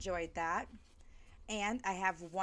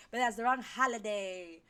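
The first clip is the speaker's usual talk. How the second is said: usual speaking voice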